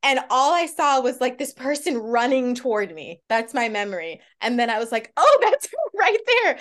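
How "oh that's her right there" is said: excited. Retold or imitated. Spotting the star running over.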